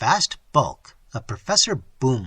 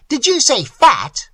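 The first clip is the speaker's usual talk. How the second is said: slow, loud, higher demand; outraged; 10 semitones higher, 9 dB louder than usual. A narrator's reading. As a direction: glare and demand it slowly. Boomley offended, glaring.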